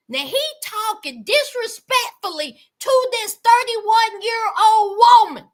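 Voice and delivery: normal talking voice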